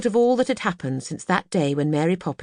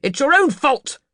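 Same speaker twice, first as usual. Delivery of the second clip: much higher and louder cross scolding; crossly; 10 semitones higher, 7 dB louder than usual. Acted. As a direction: snap at the ice cream man. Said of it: "said Mister Banks crossly".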